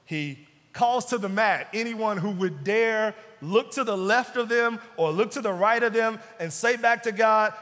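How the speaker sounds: normal talking voice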